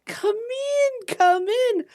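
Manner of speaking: put-on old lady shopkeeper, high, singsong welcome; Olive Stillwater. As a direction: greet customers in a high, swooping old-lady voice. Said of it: "this old lady".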